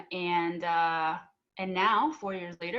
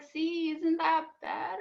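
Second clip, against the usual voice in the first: smug told-you-so; 8 semitones higher, slower than usual. Retold or imitated. Told mom after calm talk.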